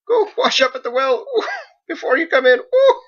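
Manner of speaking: very high, loud, giggly shooing; laughing shoo. Acted. Margot shoos the beast to the well while laughing, high. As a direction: shoo him off while cracking up.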